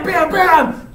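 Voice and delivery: loud, high punched syllables; imitated fight blows. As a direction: punch out sword-fight noises.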